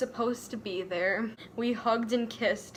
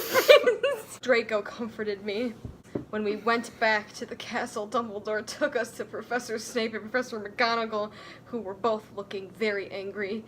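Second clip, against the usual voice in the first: normal talking voice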